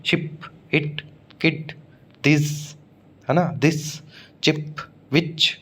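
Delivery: शिप, इट, किड, दिस, चिप, विच with a short "i"; short i vowel. Shown as a demonstration. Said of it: "एक और मोनोथंग है छोटी वाला".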